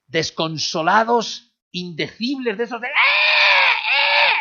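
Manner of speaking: high, loud anguished wail; wailing cries. Shown as a demonstration. Preacher demonstrating the inconsolable wailing, desperate cry. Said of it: "es una palabra que habla de gritos".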